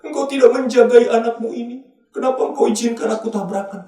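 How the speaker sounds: high-pitched mock complaint to God; acted accusing lament, pitched high; blaming God. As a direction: wail it upward like a wronged child of God. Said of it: "Terus kita menyalahkan Tuhan".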